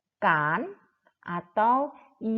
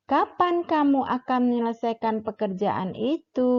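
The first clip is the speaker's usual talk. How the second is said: "Kapan" stressed, then the pitch falls to the end on "itu"; falling question intonation; 3 semitones higher than usual. Shown as a demonstration. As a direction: ask it and let the voice drop on "itu". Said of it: "[name] ulangi". (intonasi menurun, tekanan pada "Kapan", turun di "itu")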